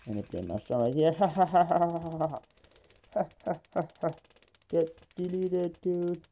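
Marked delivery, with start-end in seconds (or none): 1.2-4.1 evil laugh. Acted. Streamer gloating over his opponent with a high mock cackle. Gloating after winning push. 4.7-6.2 higher, slow hammed-up gloat; mock taunt. Streamer taunting his opponent in a higher, slow mock-villain voice.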